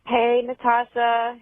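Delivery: ordinary talk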